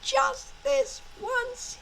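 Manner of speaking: soft, high, hopeful private plea; inner thought. Acted. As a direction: think it quietly to yourself. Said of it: "each thought to themselves".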